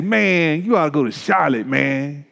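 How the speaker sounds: imitating a pastor friend from Atlanta; Tony Carter. Pastor friend urging a move to Charlotte, imitated Atlanta voice. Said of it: "you know he talk like that".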